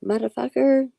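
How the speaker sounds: high, loud yell at the tape recorder; yelling at the device. Retold or imitated. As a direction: shout it at the broken machine. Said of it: "I tried yelling at it".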